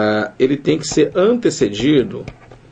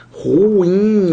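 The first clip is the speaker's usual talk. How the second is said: RUIM stretched as ru-IM, higher pitch; separação do hiato; 7 semitones higher, 4 dB louder than usual. Shown as a demonstration. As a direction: pull Ruim apart into two long syllables. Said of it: "o I que se separa". (Ruim bem alongado, em duas sílabas)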